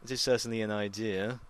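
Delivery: put-on understated English butler accent, dry and proper. Imitating how Alfred should address Master Bruce, light English butler accent. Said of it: "Alfred's supposed to be like".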